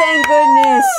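high, relieved squeal. Real, not acted. Host relieved the answer is finally right, squealing.

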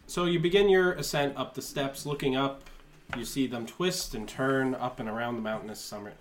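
game master scene setting. Acted. Game master describing the summit climb to players, measured narration. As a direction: paint the landscape in deliberate phrases.

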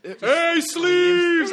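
high, loud jeering shout. Retold or imitated. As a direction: heckle him from the stands. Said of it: "What did you yell at him".

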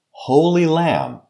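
usual speaking voice

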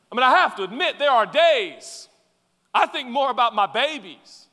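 high, loud preaching climax; impassioned preaching. Acted. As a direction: pour it out at full force. Preaching at full force.

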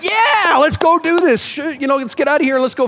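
high, loud teasing impression of eager listeners; mock excitement. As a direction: ham up the congregation's eager cheering. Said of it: "I thought you were gonna be like".

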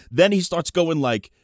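plain reading voice

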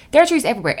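excited. Shown as a demonstration. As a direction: say it again with real delight.

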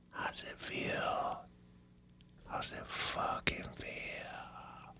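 very slow, taunting whisper; barely audible, drawn-out mock gloat. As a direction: whisper it slowly to rub it in. Gloating at sick wife, imagined.

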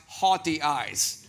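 "haughty" said slowly as HAW-tee, set against "hot"; careful haughty. Shown as a demonstration. Pastor correcting his Southern pronunciation, slow and careful. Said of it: "Not hot eyes".

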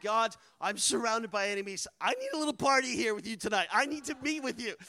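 earnest retold plea for God's help; urgent prayer. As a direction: plead upward with rising pitch. Acted desperate prayer in retelling.

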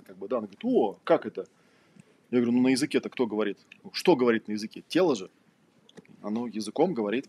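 ordinary talk, nothing marked

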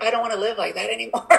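quick laughing words. Real, not acted. Laughs at her own habit.

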